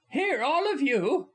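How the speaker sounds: high, loud cheerful impatience; impatient good humor. Acted. Actress Polly shooing three men out, loud and high. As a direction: shoo them with a laugh in it. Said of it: "with impatient good humor".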